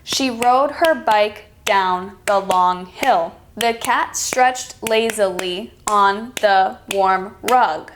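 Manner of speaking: slow, syllable by syllable, one beat per clap; slow clapped-out reading. Shown as a demonstration. Teacher clapping out a sentence for students, slow and even. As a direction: read it slowly like you're clapping each syllable. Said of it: "I clap out each sentence".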